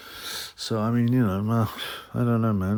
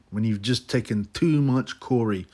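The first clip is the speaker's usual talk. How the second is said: ordinary talk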